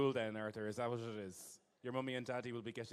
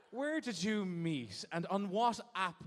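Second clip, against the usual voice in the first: slow prying question; 4 semitones higher, 4 dB louder, slower than usual. Real, not acted. Slow, loud question to couple.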